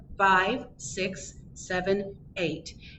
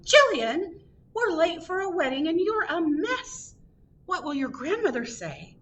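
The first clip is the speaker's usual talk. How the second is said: high-pitched, exasperated mother; yelling; 10 semitones higher than usual. A narrator's reading. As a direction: call out high, scolding your child.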